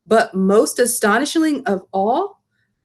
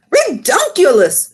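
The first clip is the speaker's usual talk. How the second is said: high, loud, slow scoff; scoffing exclamation; 8 semitones higher, 5 dB louder, slower than usual. Acted. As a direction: scoff at the silly idea. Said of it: "said the B F G".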